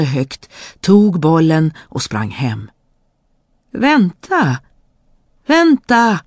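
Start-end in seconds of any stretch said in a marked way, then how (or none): 3.7-4.6 high call after her; a high frog's call after the fleeing princess. Acted. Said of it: "ropade grodan". 5.5-6.2 higher, louder frog cry; the frog shouts louder as she runs away; frog shouting. Acted. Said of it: "ropade grodan".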